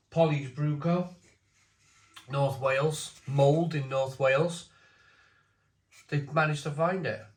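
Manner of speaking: usual speaking voice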